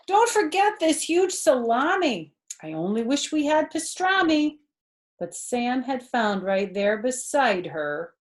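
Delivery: put-on lively read-aloud for a child; animated children's story narration; storybook reading. A narrator's reading. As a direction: read it like a bedtime picture book. Reading picture book aloud.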